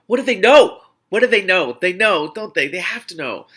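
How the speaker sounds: loud, high-pitched rising panic; panicked inner voice. Acted. Heroine panicking to herself about her hosts, loud alarmed cry.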